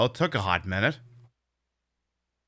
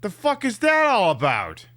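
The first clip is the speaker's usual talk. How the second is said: high, incredulous outburst; outraged disbelief; 12 semitones higher than usual. Real, not acted. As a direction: yell it in disbelief. Outraged at game.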